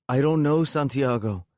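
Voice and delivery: high, loud outburst on know, then drops. A narrator's reading. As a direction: snap it back at him, voice up. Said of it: "She raised her voice".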